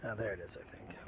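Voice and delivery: whispered, unsure. Real, not acted. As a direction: hush it, half to yourself. Parent filming, quiet aside.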